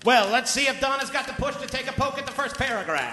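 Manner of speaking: sports commentator calling the action; play-by-play commentary. Acted. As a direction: call the writing like a fight. Play-by-play of writing as boxing.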